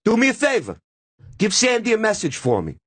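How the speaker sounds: high-pitched, emphatic mock orders; imitating Dice. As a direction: hand down an insulting message. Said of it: "points his finger, and goes".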